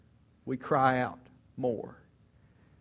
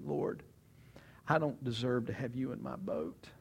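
softer, lowered confession; a touch of hushed shame; humble confession; 2 semitones lower, 9 dB quieter than usual. Retold or imitated. Peter tells Jesus he is unworthy, softer humble voice.

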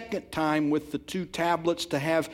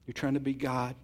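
usual speaking voice

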